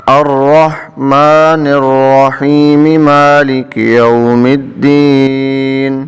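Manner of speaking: slow chant, verses joined; Quranic recitation. Shown as a demonstration. Teacher recites joined verses, slow chant. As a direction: recite both verses in one breath. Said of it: "دونوں کو ملا کر پڑھتے ہیں".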